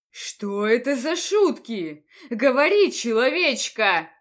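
loud, slow threatening order; menacing demand. Acted. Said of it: "грозно потребовал". (грозное требование)

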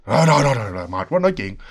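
low, tired grumble; weary giving in. Retold or imitated. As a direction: give in reluctantly, voice sinking at the end. Tired friend gives in to talk.

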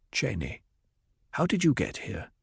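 soft, hesitant puzzlement; puzzled. A narrator's reading. Paul waking to find Chaney there, softly puzzled. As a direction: ask softly, as if just noticing them. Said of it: "with a puzzled frown".

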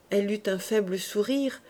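usual speaking voice

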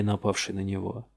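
plain reading voice

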